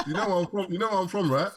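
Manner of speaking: high-pitched, incredulous appeal; mock-incredulous and animated; playful disbelief. Real, not acted. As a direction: press the obvious point, voice jumping up. Asked an obvious football-or-soccer question.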